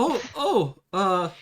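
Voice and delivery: high, startled oh oh; flustered surprise. Pretend. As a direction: caught off guard, flustered. Shopkeeper flustered by naked girl.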